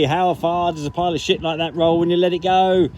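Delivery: ordinary talk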